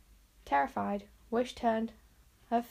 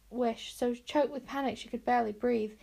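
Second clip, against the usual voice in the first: plain reading voice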